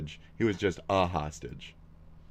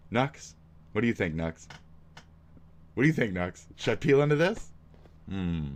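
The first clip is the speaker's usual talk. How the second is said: ordinary talk, nothing marked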